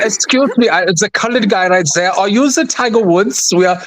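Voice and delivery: high, hammed-up fake German accent, slow on the question; a clumsy tourist from Munich doing a German accent; failed German accent. Panelist retelling the couple, badly faked German accent. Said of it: "my failed attempt at a German accent".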